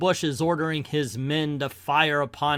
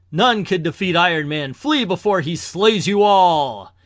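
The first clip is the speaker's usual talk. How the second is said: loudspeaker warning; 6 dB louder than usual. Acted. Iron Man warns the warlord's men to flee, loudly. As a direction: warn the troops off forcefully. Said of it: "switch my own voice into the loudspeaker".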